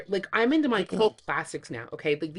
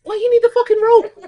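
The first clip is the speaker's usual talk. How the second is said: much higher, much louder mock yell; angry movie quote; 14 dB louder than usual. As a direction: bark it out irritated. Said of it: "and he's like".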